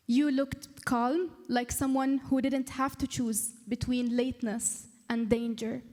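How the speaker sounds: deliberate spoken-word delivery, phrase by phrase; poem recital. Acted. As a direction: perform a heartfelt poem with weighted pauses. Reads own poem aloud.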